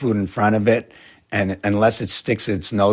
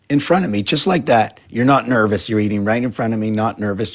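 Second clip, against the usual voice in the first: pet talk to cat; 2 semitones higher, 4 dB louder than usual. Real, not acted. Teasing the eating cat.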